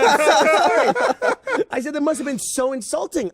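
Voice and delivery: high, drawn-out gushing apology; mortified apology. Retold or imitated. He apologizes for calling her a computer, mortified gushing. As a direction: apologize in an exaggerated high pitch.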